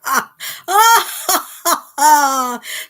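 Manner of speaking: loud, high cackling villain laugh. Pretend. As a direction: let rip a gleeful evil cackle. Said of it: "There's my villain laugh".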